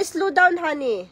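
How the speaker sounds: ordinary talk, nothing marked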